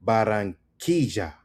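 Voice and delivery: slow "Barranquilla", ba-rran-KEE-ja; emphasis on KEE. Shown as a demonstration. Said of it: "Emphasis on key".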